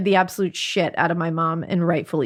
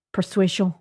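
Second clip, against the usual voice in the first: French accent. Shown as a demonstration. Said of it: "saying persuasion in a French accent".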